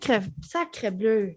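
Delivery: low, slow mock French exclamation; hammed-up French chef sigh.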